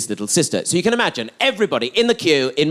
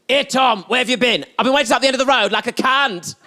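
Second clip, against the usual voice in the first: higher-pitched mock yell; mum's shout; 6 semitones higher than usual. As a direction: call out like an irate mum from the car. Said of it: "wound down the window and shouted".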